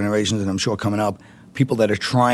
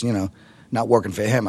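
usual speaking voice

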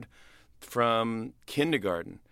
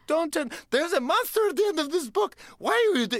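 high, pleading puppet voice, much higher and louder; scared Grover voice; 4 dB louder than usual. Retold or imitated. To the reader, Grover begs in a high scared voice. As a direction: beg like a frightened Muppet.

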